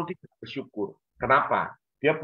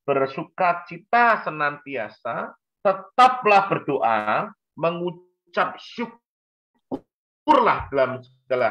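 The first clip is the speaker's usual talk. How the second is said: slow, raised reading of the verse; preacher intoning a Bible verse with weight; scripture recitation; 3 semitones higher, slower than usual. Acted.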